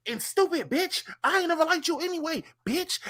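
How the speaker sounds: imitating a high-pitched tirade; mock insult tirade. Retelling what was heard on joining the space, mock high tirade.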